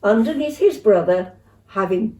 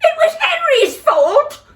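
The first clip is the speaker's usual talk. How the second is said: very high, loud protest; child shrieking his innocence; 5 dB louder than usual. Acted. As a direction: shriek the blame. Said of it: "shrieked Peter".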